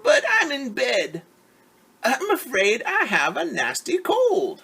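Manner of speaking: put-on high and loud scratchy old-lady voice; wolf pretending to be sick grandmother; wolf's fake granny voice. Wolf in bed faking grandmother's voice to fool Red. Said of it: "came a scratchy voice".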